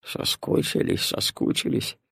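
put-on soft, husky old grandfather; low, raspy muttering of an old man; hoarse grumble. A narrator's reading. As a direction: grumble it hoarsely under your breath. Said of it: "и хрипло проворчал".

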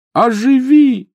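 very high, loud desperate command; frantic shrill incantation; shouted spell. A narrator's reading. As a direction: yell it with all urgency. Spell cried again after failing.

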